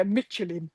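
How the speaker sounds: "Michelin" anglicised, MITCH-uh-lin; English pronunciation. Retold or imitated. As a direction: drop "Michelin" quietly with English sounds. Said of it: "hear it in English".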